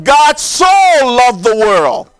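"so" held long and emphatic, pause after it; stress on SO. Shown as a demonstration. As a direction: stretch out "so", then let the phrase fall. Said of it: "is emphatic in that scripture".

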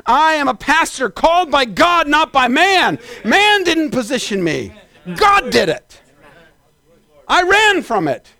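high, emphatic pulpit declaration; forceful preaching. Real, not acted. Pastor declaring he was called by God, forceful preaching. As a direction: punch out each phrase with a preacher's conviction.

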